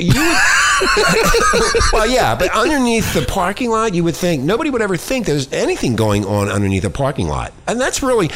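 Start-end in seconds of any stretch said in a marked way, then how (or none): 0.4-1.9 shrieking laugh. Real, not acted. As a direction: burst out in a squealing laugh. Laughing during talk.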